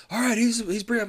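hammed-up trainee shouting his discovery; excited announcement. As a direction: proudly announce the find.

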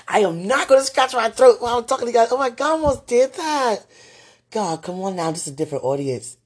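high loud embarrassed outburst; excited high fluster; flustered rant. Real, not acted. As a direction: laugh off the embarrassment loudly. Embarrassed rant about throat.

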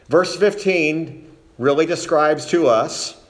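ordinary talk, nothing marked

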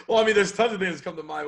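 laughing through the words, high and loud. Real, not acted. Guest amused by the host's open question, laughing. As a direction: answer through a burst of laughter.

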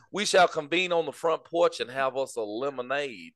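mock Southern gentleman. Planning lemonade on the porch, in a mock Southern gentleman accent. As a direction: invite them grandly to the porch. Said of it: "that old plantation Southern accent".

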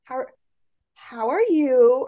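slow, halting, restarting the question; slow stumbling toddler. Retold or imitated. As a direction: imitate a little girl struggling to get it out. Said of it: "trying to slow down".

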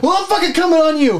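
high-pitched furious retort; heated escalating threat; angry threat. Acted. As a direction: fire back, pitch way up. Acted shouting match escalates.

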